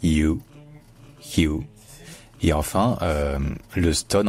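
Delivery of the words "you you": "you" as a glided hyoo; English "you" sound. Shown as a demonstration. Teacher showing how OU sounds, English glide. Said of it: "le O U se dit you".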